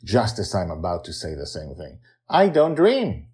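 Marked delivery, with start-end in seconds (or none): 2.3-3.3 put-on high, slow, firm child voice; a little girl setting the record straight; slow, higher child's insistence; impatient girl. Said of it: "Joy reminds Susie impatiently".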